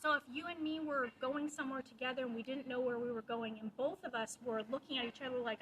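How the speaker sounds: ordinary delivery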